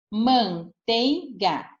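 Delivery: slow, drawn-out manteiga for the class; word said slowly. Shown as a demonstration. Teacher showing pupils the vowels, saying "Manteiga" slowly. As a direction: draw out "Manteiga" so the vowel pair stands out. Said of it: "o E e o I".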